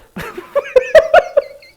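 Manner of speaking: shrill loud cackle; big laugh. Real, not acted. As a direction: burst out laughing. Loud laugh at own joke.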